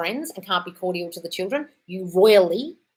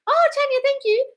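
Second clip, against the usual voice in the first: gushing thanks; 5 dB louder than usual. Real, not acted.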